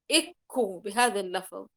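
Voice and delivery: ordinary delivery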